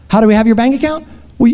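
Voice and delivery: ordinary talk, nothing marked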